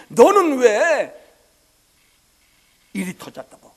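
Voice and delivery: full-voiced Korean sermon cadence; preaching. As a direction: preach it with rising force. Preaching climax.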